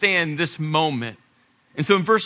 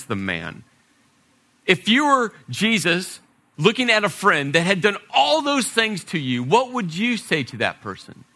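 ordinary talk

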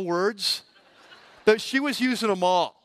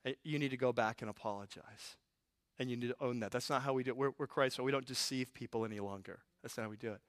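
softer, lower, gentle correction; gentle Holy Spirit; 7 semitones lower, 11 dB quieter, faster than usual. Retold or imitated. Holy Spirit gently urging believers to apologize, soft low voice. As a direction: speak to us gently, like a mentor. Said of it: "and say".

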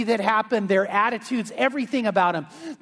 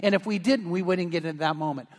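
normal talking voice

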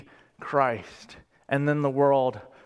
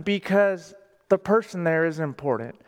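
normal talking voice

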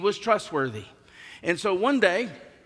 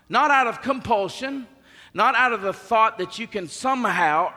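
louder, higher pulpit build, each "not out of" pushed; full-force preaching; 5 semitones higher, 6 dB louder than usual. Acted. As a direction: preach it to the back row.

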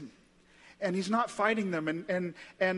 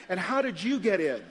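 higher, louder, tumbling questions; incredulous questioning; 4 semitones higher, 3 dB louder than usual. Acted. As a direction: press him in animated mock disbelief. Heaven's residents question the criminal.

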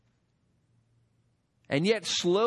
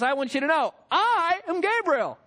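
higher, proud announcement; angel announcing himself; 7 semitones higher, 3 dB louder than usual. Retold or imitated. Gabriel declares himself to doubting Zacharias, proudly. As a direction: proclaim your name like a champion. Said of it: "he shows up and says".

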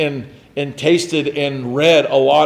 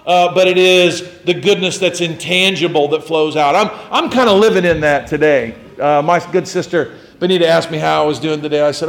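normal talking voice

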